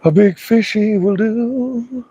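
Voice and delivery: high, slow, playful voice; drawn-out high cutesy voice; high silly voice. Pretend. Playful silly repeat to himself.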